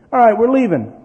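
loud, high call to go; loud impatient call to leave. Retold or imitated. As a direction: announce departure across the room. Dad calling out to leave.

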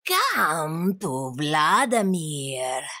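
very low, slow monster summons; icy Vladimir. A narrator's reading. Monster beckoning the children, deep icy drawl. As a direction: draw it out deep and eerie. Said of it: "and an icy voice called".

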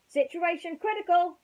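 high, loud soldier call; military shout. Acted. Green Army men shouting for rescue, loud and high. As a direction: bark it like a soldier in trouble. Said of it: "shouted the Green Army men".

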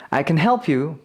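reduced CAN before a stressed HELP; unstressed can. Shown as a demonstration. Teacher repeating positive half for learners, unstressed can. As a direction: pass lightly over 'can', stress 'help'. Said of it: "강세가 없으면 긍정일 때가 많고".

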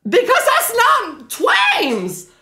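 high, slow, drawn-out outcry; exasperated protest. Real, not acted. Streamer objecting to an impostor bot, exasperated. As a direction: stretch the objection up high in outrage.

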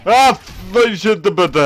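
loud, high, stammered swearing; panicked yelp. Real, not acted. Gamer caught in a fight, panicked sputtering shout. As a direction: sputter in alarm, starting very high.

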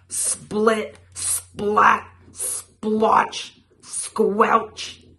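punchy sound words. A narrator's reading. Storyteller voicing flying mud, loud separate bursts. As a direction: throw each word like a handful of mud.

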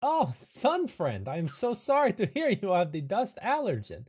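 effusive, sing-song condolence; gushing camp host. Acted. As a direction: pour on the hammed-up apology.

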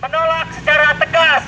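high, loud rally oratory; revolutionary orator. Real, not acted. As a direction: rouse the crowd with slogans. Rally speech, chanted slogans.

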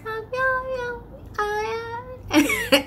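very high, garbled speaker noise; muffled distant voice. Retold or imitated. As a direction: mimic an unintelligible voice through a bad speaker. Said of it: "All I hear is".

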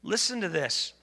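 ordinary delivery

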